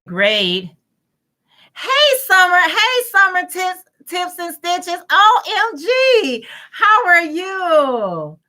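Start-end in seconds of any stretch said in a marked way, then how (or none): 1.8-8.4 high, drawn-out squeal of delight; excited greeting. Real, not acted. Host warmly squealing hello to a viewer joining the live. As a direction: gush over a friend who just joined.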